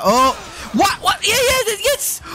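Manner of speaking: cheering a sudden turn; excited yelp. Real, not acted. Gamer yelling at the screen, excited.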